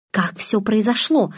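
plain reading voice

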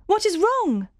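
very high, urgent call; anxious cry. A narrator's reading. Jane calling to unseen Rochester, anxious high cry. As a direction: cry the question out, pitched right up. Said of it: "I cried".

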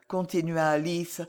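plain reading voice

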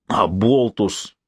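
mild, drawn-out exasperated jibe; unrestrained outburst. A narrator's reading. Maxim loses patience with his friend, mildly exasperated. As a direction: let the insult slip out slowly. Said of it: "не сдержался Максим".

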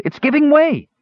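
very high, thrilled cry; high-pitched exultant yell; excited shout. A narrator's reading. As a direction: call out the breakthrough excitedly. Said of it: "he shouted".

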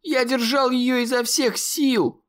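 high cry with the voice cracking; breaking shout. A narrator's reading. Conor shouting back at the monster, voice breaking. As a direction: yell it as if your voice gives way. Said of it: "закричал Конор, и голос его прервался".

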